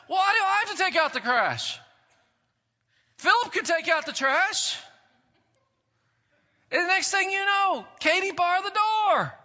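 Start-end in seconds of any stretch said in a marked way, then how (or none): 0.1-4.8 put-on child grumbling about taking out the trash; kid's whiny protest. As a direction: protest like a kid stuck with chores. Child's sibling quarrel voiced.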